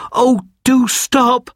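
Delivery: high, tearful cry to stop; boy who cannot bear seeing his elders kneel, crying out. A narrator's reading. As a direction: burst out in distress, near tears. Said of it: "he cried".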